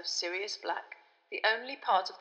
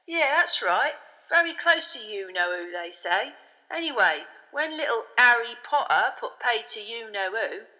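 loud, chummy know-it-all conductor; Stan the chatty conductor; 3 semitones higher, 7 dB louder than usual. A narrator's reading. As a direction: play a cocky bus conductor chatting loudly.

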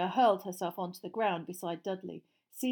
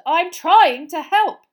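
high, loud, drawn-out exasperation; angry; 10 semitones higher, 19 dB louder, slower than usual. A narrator's reading. Mister Weasley brandishing his wand at Uncle Vernon, angrily. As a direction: shout it slowly in angry frustration. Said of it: "said Mister Weasley angrily".

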